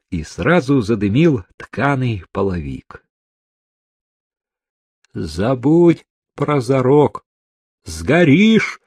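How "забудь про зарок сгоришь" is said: raised, insistent cry climbing high; urgent shout. A narrator's reading. Evpatiev warning the woman in the fire, crying out. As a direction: call out a warning, rising on the last word. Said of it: "крикнул Евпатьев".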